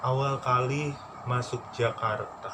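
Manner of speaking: ordinary talk, nothing marked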